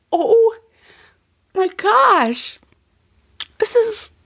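high-pitched, broken up by long pauses; moved, halting, with a touch of laughter; overcome with emotion. Real, not acted. Moved retelling of son's forgiveness.

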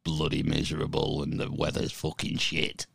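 low, grumbling Northern English moan; gloomy Northern English. Retold or imitated. Brit mocks Northerners grumbling in a Northern English accent. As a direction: moan like a miserable Northerner in the cold. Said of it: "battling the elements and the cold weather".

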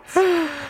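sharp high gasp of disbelief; a sudden gasp at something absurd; shocked gasp. Real, not acted. Host gasps at the agency notice, sharp high gasp. Reacting to the CFIA notice wording.

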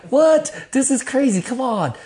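high-pitched, exasperated disbelief; loud "what", then easing off in protest; incredulous protest. Retold or imitated. His protest at being made to stay.

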